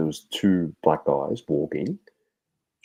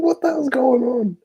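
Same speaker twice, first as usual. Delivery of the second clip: incredulous outburst; 5 dB louder than usual. Retold or imitated. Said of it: "I'm like".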